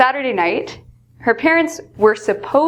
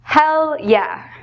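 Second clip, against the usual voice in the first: enthusiastic yes; 6 semitones higher than usual. Shown as a demonstration. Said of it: "feel very strongly".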